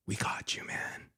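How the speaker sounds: hushed unvoiced whisper; secretive conspiratorial whisper. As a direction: lean in and whisper it. Repeated line, now whispered.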